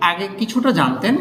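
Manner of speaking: ordinary talk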